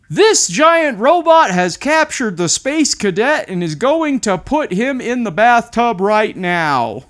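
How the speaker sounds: high, loud playful robot announcement; dad playing giant robot at bath time; dad voicing a giant robot. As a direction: boom it out like a toy robot capturing a hero. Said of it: "Should I do that in a robot voice?".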